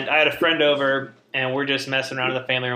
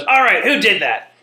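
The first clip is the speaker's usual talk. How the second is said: loud, raised-pitch mock scolding; stern mom; 7 semitones higher, 7 dB louder than usual. Mom calling out to find the culprit, stern. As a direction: demand it like a fed-up mother. Mom questioning kids after the scream.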